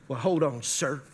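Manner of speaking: low, restrained mock politeness; passive aggressive. Call center agent putting an angry customer on hold, passive aggressive. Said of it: "passive aggressive".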